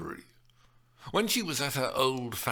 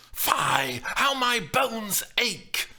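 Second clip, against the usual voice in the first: high, loud, slow angry grumble; growled complaint; 13 semitones higher, 6 dB louder, much slower than usual. Acted. The nurse growling about her aching bones. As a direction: spit out the aches with venom. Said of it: "Chloe growled".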